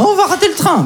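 high, anxious mother wailing we'll miss the train; shrill-pitched worried mother voice; panicky mother. Retold or imitated. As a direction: squeal it like a fretting mum.

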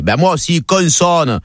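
Marseille "consonne", n sounded; Marseille accent. Acted. Dédé echoing the Parisian, Marseille accent. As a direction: copy him in a Marseille accent. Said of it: "Et Dédé, il dit".

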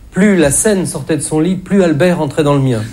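ordinary talk, nothing marked

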